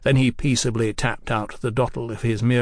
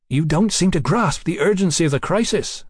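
higher, pressing reproach; exasperated urgency; 5 semitones higher than usual. Acted. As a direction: press the point with alarm. Mallory urges the crisis.

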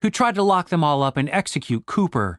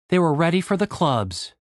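ordinary delivery, nothing marked

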